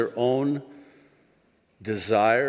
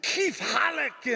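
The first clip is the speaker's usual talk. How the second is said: high, drawn-out rough Arabic greeting; 14 semitones higher, slower than usual. Shown as a demonstration. Comic showing how rough Arabic sounds, harsh Arabic. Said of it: "Arabic's a really rough language".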